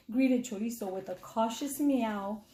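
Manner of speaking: plain reading voice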